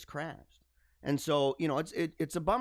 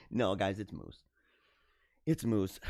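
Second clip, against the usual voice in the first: mock-stern correction; 9 semitones lower than usual. Real, not acted. Mock-correcting chat on moose plural.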